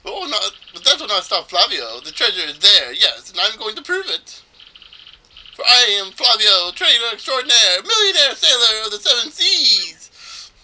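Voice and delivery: loud, high, flamboyant self-proclamation; as fey as possible. Acted. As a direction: proclaim yourself grandly, stretching the big finish. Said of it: "make this guy sound as fey as possible".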